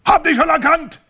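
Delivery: very high, loud shout. A narrator's reading. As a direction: shout it cheerfully. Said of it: "schrie Herr Schelmhorn".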